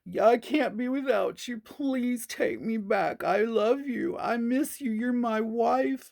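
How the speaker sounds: high, slow whiny begging; lightly mock a crying ex; a hint of a sobbing husband; mock pleading husband. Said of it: "crying and screaming about".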